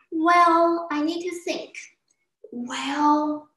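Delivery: buy time with a long "Well"; hesitant pondering. Acted.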